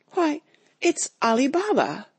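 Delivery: high, delighted cry of recognition; thrilled, high exclamation; ecstatic exclaim. A narrator's reading. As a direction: burst out in childlike joy. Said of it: "Scrooge exclaimed in ecstasy".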